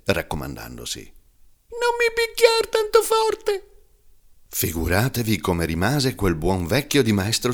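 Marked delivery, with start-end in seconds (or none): 1.0-3.7 very high, slow pleading little voice; a wooden log's high begging plea; tiny thin voice. Acted. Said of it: "una vocina sottile sottile".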